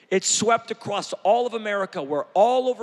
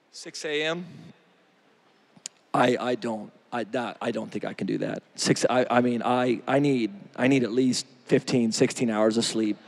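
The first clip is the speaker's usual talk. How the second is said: lower, stammering whine of an excuse; mock lazy friend; 9 semitones lower than usual. Preacher mimicking a friend who won't get up early, lower mock whine. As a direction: play the sleepy friend making excuses.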